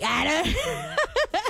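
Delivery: high giggling burst; real laugh at your own joke. Real, not acted. Streamer joking about parking mom, high giggle.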